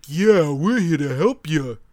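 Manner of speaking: slow, dopey, thick-headed hero voice; big galoot voice. Retold or imitated. Friend mocking the show's dumb hero, slow and dopey. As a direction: drawl it out like a big lovable oaf. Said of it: "The entire time it's just like".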